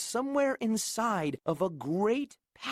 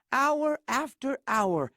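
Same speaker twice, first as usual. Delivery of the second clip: plain reading voice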